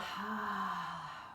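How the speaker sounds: slow audible exhale; long sigh out. Shown as a demonstration. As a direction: let out a long relaxing sigh. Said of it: "long breath out".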